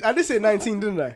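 high, loud embarrassed laugh through words; laughing at himself. Real, not acted. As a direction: admit the slip while laughing. Caught in his date mistake.

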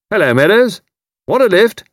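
high, loud hearty hail from a car; hearty shout. A narrator's reading. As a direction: call out cheerfully to a friend on the road. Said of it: "hailed by the hearty voice of Commander Haydock".

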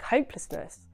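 ordinary talk, nothing marked

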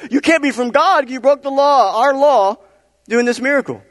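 loud, high-pitched outraged accusation; accusing Pharisees. Acted. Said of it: "The Pharisees argued with the man".

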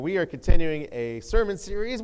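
loud, upbeat series announcement; first, lesser announcement. Shown as a demonstration. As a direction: announce it brightly, short of a shout. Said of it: "that wasn't quite up to par".

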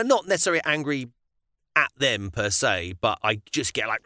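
normal talking voice

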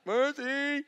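put-on high, slow, begging child; pleading kid voice. Dad voicing his son begging for mercy. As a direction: beg for mercy. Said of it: "they're like".

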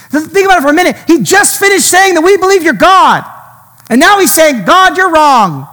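preacher stunned that Peter corrects God; rising preaching intensity. Real, not acted. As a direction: drive home the shock of the rebuke. Preacher builds on Peter's rebuke.

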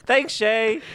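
high bright thank-you; cheery thanks. Real, not acted. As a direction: sing out the thanks happily.

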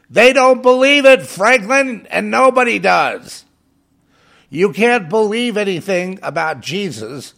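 loud, high, furious rebuke; angry outburst. Real, not acted. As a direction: shout it right at Franklin. Rebuking Franklin Graham directly.

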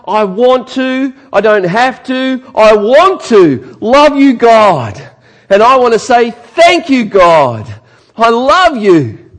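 high, loud, slow heartfelt praise; heartfelt worship. Pretend. Worshipper voiced by the preacher, loud, high and slow. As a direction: pour out love to God. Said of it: "I'm hearing sincere".

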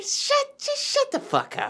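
high-pitched mock outburst; comically high, fed-up snap; mock irritation. Snapping at morning talkers, acted.

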